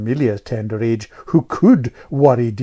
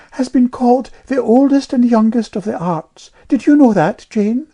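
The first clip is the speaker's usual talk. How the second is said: put-on high, louder piping girl; piping know-all child; 12 semitones higher, 7 dB louder than usual. A narrator's reading. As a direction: pipe up like a little know-all. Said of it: "piped Amelia".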